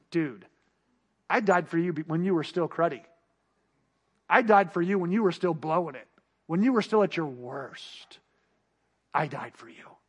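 gentle God voice. Retold or imitated. God gently answers the boastful believer, tenderly. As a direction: speak like a loving father reassuring a child. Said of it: "And God says".

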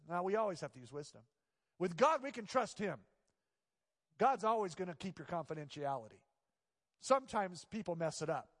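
ordinary talk, nothing marked

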